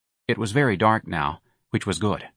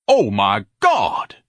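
a louder, very slow drawn-out bellow; 5 dB louder, much slower than usual. A narrator's reading. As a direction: bellow it slowly in dismay. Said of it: "Ignatius bellowed".

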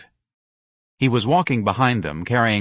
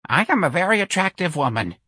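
put-on much higher old-lady babble; babbling old woman; 9 semitones higher than usual. A narrator's reading. Old clerk babbling about her looks. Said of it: "Miss Trixie babbled".

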